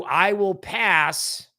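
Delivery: drawn-out, slow I will pass; slow refusal. Real, not acted. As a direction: say I will pass slowly, savoring it. Declining to post bearish takes.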